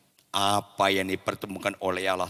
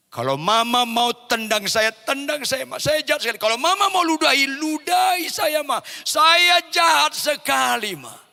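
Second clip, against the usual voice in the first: high, loud acted remorse; anguished begging; 7 dB louder than usual. Husband at wife's feet asking punishment, high anguished pleading. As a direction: beg with rising desperation.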